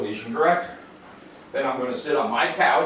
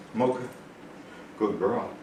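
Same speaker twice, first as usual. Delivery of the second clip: 1 soft praise; 5 semitones lower, 10 dB quieter than usual. Real, not acted.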